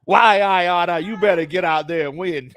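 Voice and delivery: a hint of a tough 1920s boss chewing out his team; 1920s gangster. Owner scolding his losing team, a mild 1920s gangster voice. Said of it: "you sound like a nineteen twenties gangster".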